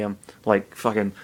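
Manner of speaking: usual speaking voice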